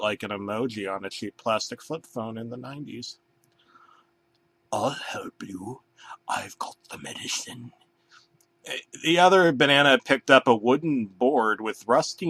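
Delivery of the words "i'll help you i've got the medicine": sinister soft whisper. Acted. Banana offering fake medicine to the hurt bear, whispered. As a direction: murmur it with fake kindness.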